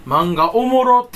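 slow, loud fake Kansai "おもろ"; fake Kansai dialect. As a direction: drag out "おもろ" in mock Kansai. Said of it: "エセ関西弁". (ゆっくり大声のエセ関西弁)

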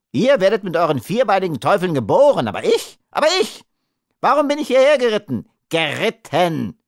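high, rushed, exasperated outburst; agitated wailing rant. A narrator's reading. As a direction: rail in high-pitched self-pity. Little man laments, ranting.

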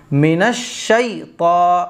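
منش شیطا slow, with a full sh sound; slow tajweed on منش شیطا; correct recitation. Shown as a demonstration. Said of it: "شے نہیں پڑھا جائے گا".

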